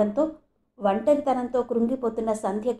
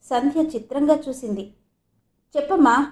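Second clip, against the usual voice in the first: usual speaking voice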